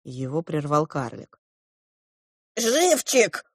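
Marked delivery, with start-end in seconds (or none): 2.5-3.4 high-pitched dwarf voice; exclaiming dwarf. A narrator's reading. The dwarf interrupts the silence, exclaiming. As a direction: cry out high, as a little dwarf. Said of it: "воскликнул он".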